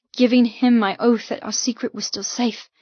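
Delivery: plain reading voice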